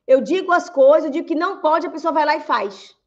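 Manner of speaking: acted-out frustration; mock exasperated complaint. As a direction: complain that nobody listens. Acted complaint of being ignored.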